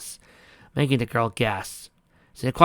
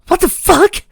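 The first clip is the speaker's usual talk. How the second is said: shrill high scream of shock; 13 dB louder, slower than usual. Acted. Twilight screaming at Octavia after the assault. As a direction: scream it, far higher and louder. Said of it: "Twilight screamed".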